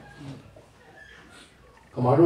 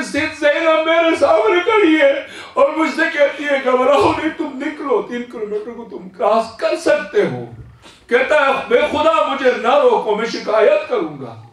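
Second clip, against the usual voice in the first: high shouting cry of a vision; ecstatic shout; 10 semitones higher than usual. Acted. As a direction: cry out that she stands before me. Youth proclaims Zainab appeared.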